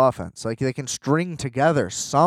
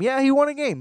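sarcastic, much higher, leaning on won; sarcastic echo; 10 semitones higher than usual. Real, not acted. Podcaster mocking fans about Lamar's win, sarcastic and higher. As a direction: throw it back at them with scorn. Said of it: "Good for him".

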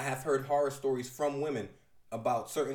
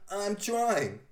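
higher, louder strained yell; higher strained cry from the floor; strained shout; 6 semitones higher, 4 dB louder than usual. Acted. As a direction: shout it while being knocked down. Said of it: "the dude getting clothesline screams".

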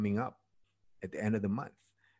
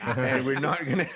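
laughing through the retold thought; can't keep a straight face finishing the quote; giggling at his own plan; 4 semitones higher than usual. Retelling his thoughts before the party.

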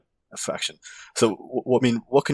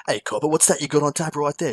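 higher broad Aussie bogan voice; 6 semitones higher than usual. Retold or imitated. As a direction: order at the pub like a rough Aussie bloke. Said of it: "talking like someone I think that would clean his teeth".

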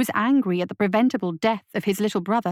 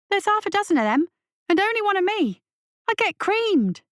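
put-on much higher, whiny young voice; protesting boy; 11 semitones higher than usual. A narrator's reading. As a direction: complain like an outnumbered kid.

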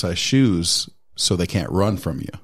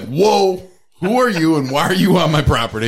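startled homeowner; 6 semitones higher, 3 dB louder than usual. Retold or imitated. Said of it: "I'm like".

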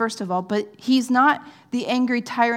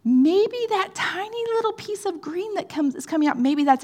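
much higher, eager peering voice; hopeful gardener; 9 semitones higher than usual. Retold or imitated. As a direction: squint at the soil, hoping it's a sprout. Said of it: "we're like".

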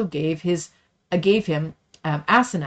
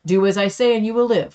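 harsh command; 5 semitones higher, 7 dB louder than usual. A narrator's reading. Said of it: "spoke to them harshly".

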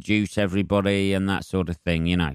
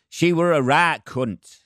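put-on slow, high Leeds accent with a blunt fall; imitating a Leeds woman in the audience; 8 semitones higher, 3 dB louder, slower than usual. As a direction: drawl it out like a Leeds local. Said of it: "she said in a fucking Leeds accent".

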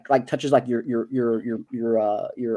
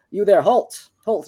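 raised, louder call to halt; guard's challenge; 6 semitones higher, 7 dB louder, slower than usual. Acted. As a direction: call out sharply to stop someone. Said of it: "kinda, like, telling you to stop".

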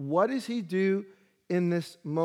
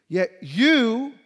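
"you" higher and louder, pointing at God; accusing stress; 5 semitones higher, 7 dB louder than usual. Acted. Pastor voicing David accusing God, higher and louder. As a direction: jab the "you" like a pointed finger. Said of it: "like pointing the finger at God".

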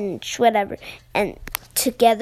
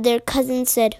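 ordinary delivery

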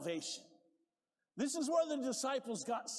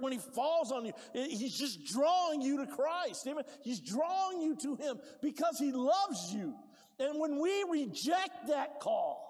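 preaching harder and louder, breaking into shouts; 5 semitones higher, 3 dB louder than usual. Acted. Pastor urging the congregation, building to a shout. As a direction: push the message home with rising fervor.

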